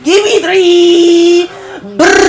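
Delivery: high, loud, slow pleading yell; excited shout. Real, not acted. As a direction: shout at the screen for a three. Yells at the game.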